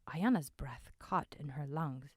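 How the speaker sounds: plain reading voice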